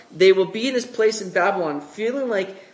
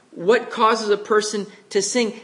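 ordinary talk, nothing marked

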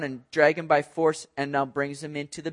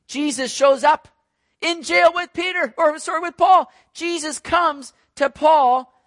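preaching at full force; 9 semitones higher, 6 dB louder than usual. Acted. Preacher to congregation, proclaiming Jesus comes, full force. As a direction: preach it out to the back row.

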